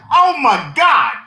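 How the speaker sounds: loud, high, drawn-out exclamation; slow it down and wail it, appalled; retold outraged cry. Trainer squirted in the mouth by an inhaler, retold outcry.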